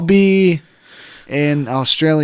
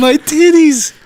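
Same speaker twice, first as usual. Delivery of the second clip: high, louder put-on answer; English stripper; 11 semitones higher, 5 dB louder than usual. Stripper answering the same question, high louder voice. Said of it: "I'm just an English stripper".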